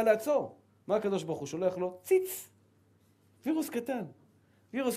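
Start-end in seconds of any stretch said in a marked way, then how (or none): 2.0-5.0 squeaky tiny virus. Acted. Tiny virus sent from above.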